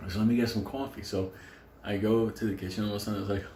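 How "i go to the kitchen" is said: usual speaking voice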